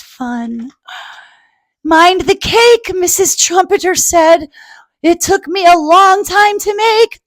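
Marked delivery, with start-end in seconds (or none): 1.8-7.2 "It took me a long time to make" shouted high and loud; alarmed mother. Acted. Mother warns splashing kids.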